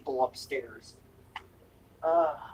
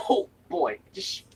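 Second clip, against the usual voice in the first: ordinary talk, nothing marked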